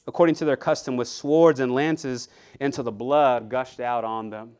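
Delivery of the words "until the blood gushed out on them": ordinary talk, nothing marked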